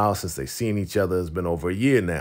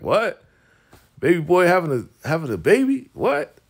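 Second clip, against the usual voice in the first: high-pitched mock disbelief, rising to a squeal; astonished uncle; 10 semitones higher, 4 dB louder than usual. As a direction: imitate an uncle stunned by baby news. Uncle reacts to pregnancy news.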